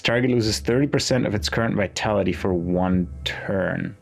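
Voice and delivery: ordinary talk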